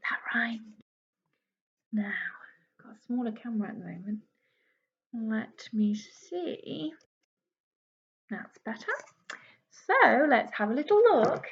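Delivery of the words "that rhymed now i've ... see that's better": breathy unvoiced whisper; whispered aside. Real, not acted. Whispering an aside to the children.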